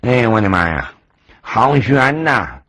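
puffing like he just ran for his life; breathless old Taoist; panting. Acted. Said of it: "曹老道直喘粗气".

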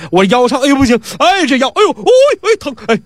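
high-pitched theatrical groans; hammed-up pain in short gasped cries; feigned agony. A narrator's reading. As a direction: yelp and moan over the sore back. Feigns back pain to extort.

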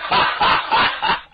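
big stagey ha-ha-ha; hearty acted laugh. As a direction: laugh aloud like an amused lord greeting a favourite. Lord laughs greeting his favourite general.

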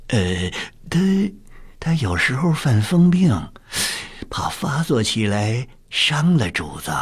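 hesitate, then warn the master anxiously; hesitant eunuch. A narrator's reading. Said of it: "掌钥匙的太监迟疑了一下". (太监迟疑地禀告)

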